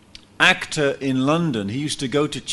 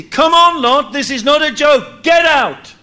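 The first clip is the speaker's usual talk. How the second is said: high, loud commanding shout; angels shouting; 10 semitones higher, 9 dB louder than usual. Acted. Angels ordering Lot out of the city, loud shout. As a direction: drive him out with a yell.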